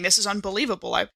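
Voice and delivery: ordinary talk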